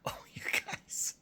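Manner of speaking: soft, whispered creature groan; voiceless mock-dismayed sigh; weary wounded wererat. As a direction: whisper it as a hunted, hurt rat. Voices wounded wererat being chased.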